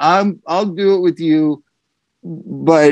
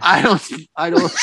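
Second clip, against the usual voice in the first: imitating Korean accent. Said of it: "Wait, how'd he say it?".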